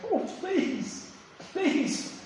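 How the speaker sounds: high, soft whimpering plea; a thin, high, hushed beg for mercy; begging. Retold or imitated. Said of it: "He cried and he begged".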